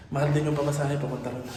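ordinary talk

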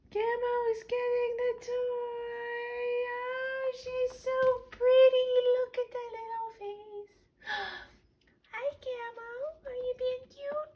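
high sing-song cooing. Real, not acted. Cooing over cat on camera.

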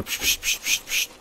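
urgent unvoiced shushing hiss; a run of sharp psst sounds. Real, not acted. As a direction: hush the room, barely breathing out. Shushing while sneaking past enemy.

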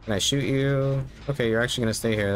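usual speaking voice

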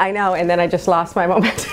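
ordinary delivery

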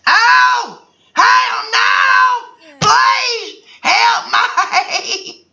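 panicked shout. Real, not acted. Panicked reaction to game.

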